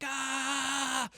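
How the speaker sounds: put-on high excited kid's shriek; excited kid yell. To his wife, imitating playground kids with a high shriek. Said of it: "you're just like".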